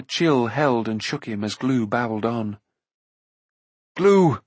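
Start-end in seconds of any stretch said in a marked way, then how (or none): 4.0-4.4 short, high, louder call; sudden desperate call; despairing cry. A narrator's reading. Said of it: "he cried, despair welling up in him".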